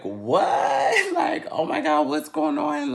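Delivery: loud what, then high panicky squeal; shocked. Retold or imitated. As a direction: react in hammed-up disbelief. Child shocked mother is coming along.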